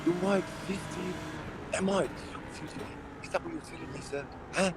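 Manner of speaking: high-pitched, playful disbelief; mock incredulity. Driver teasing a skilled rival called Noob, mock incredulous. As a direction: act affronted, voice pitched well up.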